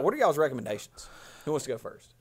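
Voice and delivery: usual speaking voice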